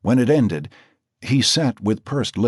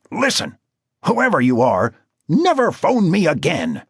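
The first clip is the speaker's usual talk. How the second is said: angry; 9 semitones higher, 4 dB louder than usual. A narrator's reading. Wigmore yells at caller.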